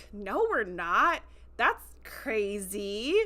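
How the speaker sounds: drawn-out, overdone innocent protest; unconvincing denial. Retold or imitated. Cadets bluffing when accused of being Starfleet, unconvincing denial. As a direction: stretch it out like a terrible liar.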